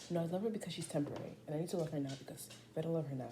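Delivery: usual speaking voice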